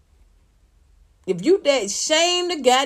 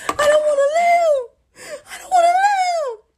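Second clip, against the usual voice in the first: high wailing mock sob, much louder and slow; mock sobbing; 5 dB louder, slower than usual. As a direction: wail it out like a hammed-up crying witness. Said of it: "So she said it like this".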